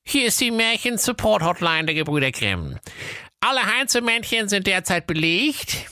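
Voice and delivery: high recorded dwarf announcement; dwarf voice recording. A narrator's reading. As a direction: read it like a tiny dwarf on tape. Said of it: "eine Zwergenstimme vom Band".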